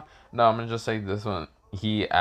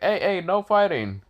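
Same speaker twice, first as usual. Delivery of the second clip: higher-pitched mock warning; scolding objection; 7 semitones higher than usual. Bar tells Slick to stop fighting, lightly scolding. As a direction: call out a quick telling-off.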